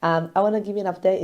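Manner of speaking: usual speaking voice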